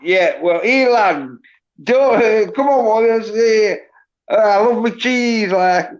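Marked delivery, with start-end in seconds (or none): 0.6-5.5 high, loudish broad Yorkshire voice; Wallace in Yorkshire accent. Shown as a demonstration. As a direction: put on a high-pitched cartoon Yorkshire accent. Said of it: "Yorkshire accent".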